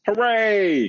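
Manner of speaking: very high, loud gleeful hooray; joyful cry. A narrator's reading. Princess cries out in joy as the frog returns her ball. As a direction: cheer at getting the ball back. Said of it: "she cried".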